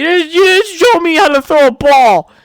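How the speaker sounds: high, loud, whiny tantrum scream; screaming at dad. Acted. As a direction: scream it like a hurt kid blaming his father.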